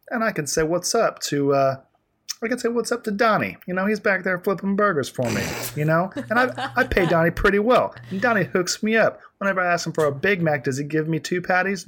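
high-pitched, play-acted boasting; excited pretend rich kid. Pretending to be Richie Rich greeting Donnie, high excited voice. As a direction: pitch it up, living out a kid's daydream.

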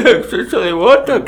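high, far put-on diva voice; mock botox diva. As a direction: play a vain botoxed diva talking.

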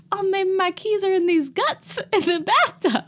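very high ditzy Southern voice; Southern belle. Acted. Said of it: "sounds like a Southern belle".

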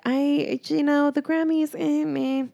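high, unimpressed meh; mock-bored eh, meh; bored shrug. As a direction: shrug off the Grammys. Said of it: "I was like".

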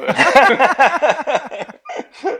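big high burst of laughter; hearty laugh. Real, not acted. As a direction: laugh out loud, high and free. Guest laughs at belle-mère joke. (éclat de rire aigu et franc)

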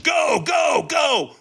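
hollered start command; trainer's shout. Retold or imitated. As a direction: yell go like a trainer launching you. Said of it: "the trainer went".

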